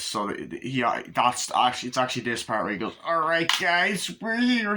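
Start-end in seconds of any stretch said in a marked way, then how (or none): 3.0-4.8 slow, high imitated dude voice, rising on here; hammed-up impression of the character arriving; announcing arrival. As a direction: drag out guys like a goofy friend. Same line imitated again.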